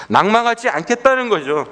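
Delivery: high-pitched, resolute preaching; emphatic preaching. Real, not acted. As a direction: drive the resolve home to the congregation. Preaches with raised voice.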